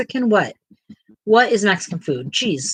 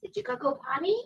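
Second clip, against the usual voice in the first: higher and much softer; 9 semitones higher, 10 dB quieter, much faster than usual. Real, not acted. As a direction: ask it sweetly up high. Asking the baby softly.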